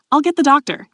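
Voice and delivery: panicked. A narrator's reading. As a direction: jump in with urgent, understated panic. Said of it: "he said in a panic".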